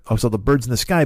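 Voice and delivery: usual speaking voice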